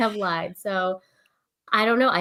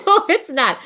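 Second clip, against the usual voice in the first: laughing; 11 semitones higher, 6 dB louder than usual. Real, not acted. Laughing denial of lying.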